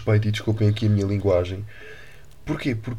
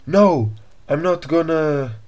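English tourist; 6 semitones higher, 5 dB louder, much slower than usual. Retold or imitated. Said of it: "o homem começou, tipo, a dizer".

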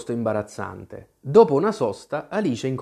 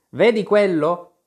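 higher, louder agitated question; voice choked with emotion; 6 semitones higher, 5 dB louder than usual. A narrator's reading. Tweedledum points at the rattle, agitated, emotional. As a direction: ask it with mild agitation. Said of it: "disse con voce rotta dall'emozione".

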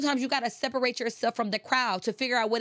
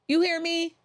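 calling out; 8 semitones higher, 4 dB louder than usual. Acted. Repeated call, much higher and louder.

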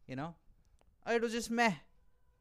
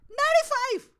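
disbelief; 11 dB louder than usual. Real, not acted. Outrage at friend's long ride.

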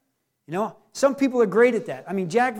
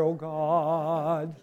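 low, mock-pious intoning; holy righteous prayer; 7 semitones lower, 6 dB quieter than usual. Mocking those who need a holy prayer, low and sanctimonious. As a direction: intone it with drawn-out false solemnity. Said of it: "holy, righteous prayer".

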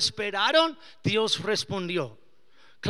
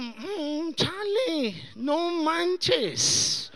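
higher, playful exasperation; mock exasperation; 8 semitones higher, much slower than usual. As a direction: groan at being outplayed. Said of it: "Y yo como". (fastidio juguetón)

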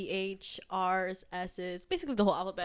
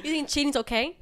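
mock accusing; 6 semitones higher, 5 dB louder than usual. Mock interrogating girlfriend.

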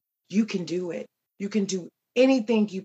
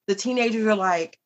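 ordinary delivery

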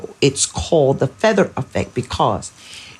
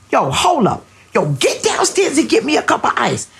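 fed-up mom yelling; 11 semitones higher than usual. Retold or imitated. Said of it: "I was like".

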